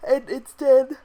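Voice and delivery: talking through a yawn; words stretched by a yawn. Real, not acted. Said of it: "a yawn".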